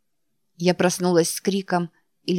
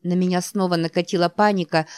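usual speaking voice

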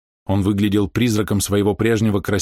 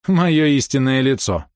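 slow, higher scornful echo; contemptuous; 5 semitones higher, 3 dB louder, slower than usual. A narrator's reading. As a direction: throw it back with a sneer. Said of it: "презрительно усмехнулась та". (презрительно, с усмешкой)

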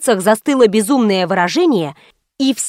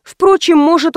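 usual speaking voice

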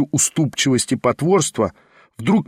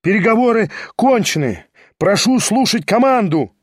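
higher-pitched, ringing order; shouted command; 6 semitones higher than usual. A narrator's reading. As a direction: call the duel to order in a raised voice. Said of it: "изо всей силы вскричал Кириллов". (громкая команда)

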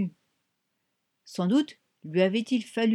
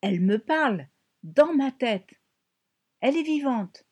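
put-on higher, eager child's exclamation; exclaiming; 5 semitones higher, 4 dB louder than usual. A narrator's reading. Franny telling her mother about her sister, excitedly. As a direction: announce the secret with excitement. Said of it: "s'exclama Franny".

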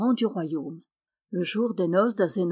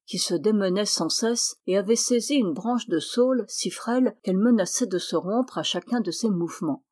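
usual speaking voice

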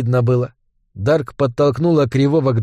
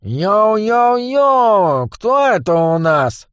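high, slow nasal singsong; nasal sing-song gangster; 7 semitones higher, much slower than usual. Acted. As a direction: croon it like a cocky goblin boss. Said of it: "гнусавым голосом пропел". (гнусаво нараспев)

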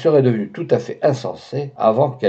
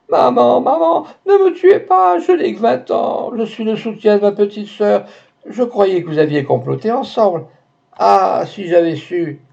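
terrified shriek; 9 semitones higher than usual. A narrator's reading. The maid begging Max not to kill her, terrified shrieking. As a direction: shriek for your life, begging. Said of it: "en hurlant de nouveau avec une énergie sans limite".

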